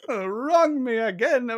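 high, drawn-out mock plea; melodramatic mimicry. Friend echoing Renfield's line, high melodramatic mock plea. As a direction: stretch it into a hammy cry. Echoes the acted Renfield quote.

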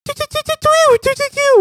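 high soft bird chirps; chirping bird person. Shown as a demonstration. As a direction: chirp like a little songbird. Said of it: "kayak suara burung-burung merdu".

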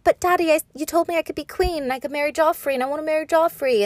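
high, fast, whiny daughter voice; bratty pleading to Daddy; whiny Sansa. Retold or imitated. Mocking Sansa begging her father, whiny high voice. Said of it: "Sansa's like".

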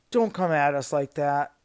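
loud, indignant warning; heated. Real, not acted. Worker warning rude customers off, loud and heated. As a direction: let the anger push it out forcefully. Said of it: "I'm getting heated just thinking about it".

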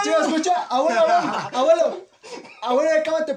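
llamando al abuelo fuerte y agudo; llamado a gritos. Acted. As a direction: grita como si el abuelo no oyera. Llama al abuelo en escena actuada. (llamado insistente a voces, actuado)

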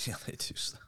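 laughing whisper; breathy laughing aside. Real, not acted.

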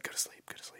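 whispered lulling, very soft; soothing whisper. Retold or imitated. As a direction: coax him to sleep in a whisper. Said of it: "I was like".

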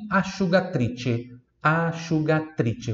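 slow asciugatrice, sci as sh; slow model word. Shown as a demonstration. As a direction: draw out asciugatrice slowly. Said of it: "repita em voz alta". (asciugatrice bem devagar)

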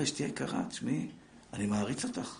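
soft, gentle praise to a wife; tender to wife. Shown as a demonstration. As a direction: say it softly, like you mean it. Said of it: "תגיד לה". (פנייה רכה ושקטה לאישה)

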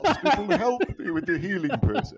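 mock therapist. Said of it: "did your shrink tell you".

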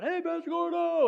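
high, loud shout across the street; calling out. Retold or imitated. Passerby hailing the running pastor, loud call. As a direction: call out a greeting from afar. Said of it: "I heard somebody yelling".

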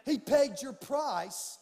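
loud, slow, rising preacher's cry; preaching at full force. Acted. Pastor urging his church to go all in, loud preaching. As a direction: build it to a shouted climax. Building sermon climax.

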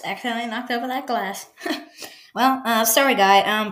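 ordinary delivery

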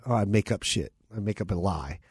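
ordinary delivery

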